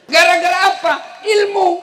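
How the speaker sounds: high, loud rousing call; preaching shout. Real, not acted. Preacher calling out to the children, high loud shout. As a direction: punch it out to the crowd.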